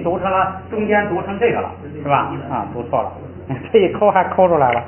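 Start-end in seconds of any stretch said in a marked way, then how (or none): none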